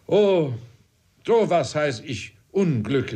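a corporal crying out at another's misfortune; cried out. A narrator's reading. Trim cries out over a poor man's misfortune, rising lament. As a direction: lament it aloud, Oh rising high. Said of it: "rief Trim".

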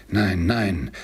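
mild pleading cry of no. Acted. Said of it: "rief Scrooge".

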